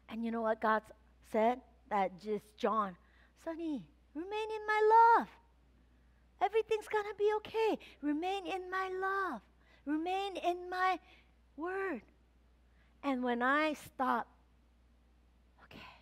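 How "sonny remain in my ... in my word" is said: higher, soft, tender reassurance; gentle voice of God. Acted. God reassuring Sonia, gentle and soft. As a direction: comfort her like a loving father. Voicing God's reply.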